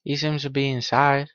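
ordinary talk

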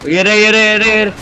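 excited, high-pitched enemy callout; urgent callout. Real, not acted. Player spotting enemies for teammates, excited callout. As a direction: call out the sighting in a high, rapid repeat. Spots enemies during live game.

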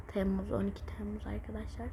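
hushed, unvoiced whisper. Real, not acted. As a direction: whisper the date to the viewers. Whispered date. (fısıldayarak)

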